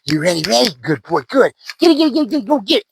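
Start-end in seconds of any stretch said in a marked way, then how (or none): 1.8-2.9 high, eager urging; fast high coaxing, get it; excited. Real, not acted. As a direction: hype the dog up to grab it. Firing up the dog to bite.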